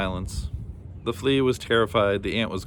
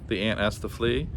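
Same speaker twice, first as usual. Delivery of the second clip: usual speaking voice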